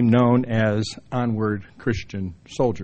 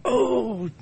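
put-on embarrassed teenager; 9 semitones higher, slower than usual. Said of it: "it was like".

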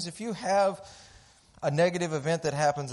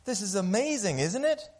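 excited neighbors; 6 semitones higher than usual. Acted. Neighbors gush to the farmer.

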